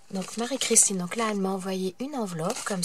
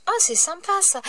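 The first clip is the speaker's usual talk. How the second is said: much higher, thrilled exclamation; light up at a surprise gift; delighted; 9 semitones higher than usual. Real, not acted. Opening the pouch, delighted. (enthousiasme aigu, ravie)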